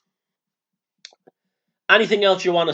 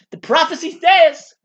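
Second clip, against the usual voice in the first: a hint of a Southern preacher, raised and loud; 10 semitones higher, 5 dB louder than usual. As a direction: lightly proclaim it like a Southern revival preacher. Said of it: "now you sound like a southern preacher".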